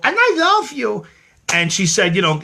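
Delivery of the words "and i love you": quick, very high falsetto line; quick sing-song. Retold or imitated. Quick high-pitched love you to [name], playful. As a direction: toss it off in a high little lilt. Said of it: "I did a quick little".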